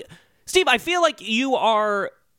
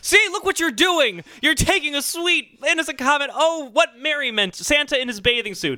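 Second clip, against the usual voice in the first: exasperated and high; 6 semitones higher than usual. Acted. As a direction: raise your voice in protest. Mocking sweet comment, raised voice.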